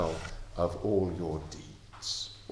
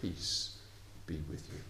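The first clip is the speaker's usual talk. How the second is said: quieter, tender Jesus words; soft gentle peace; 5 semitones lower, 11 dB quieter than usual. Acted. Jesus greeting the disciples who failed him, softly tender. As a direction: breathe it gently like a blessing. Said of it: "He says".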